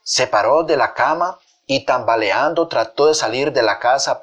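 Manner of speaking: ordinary delivery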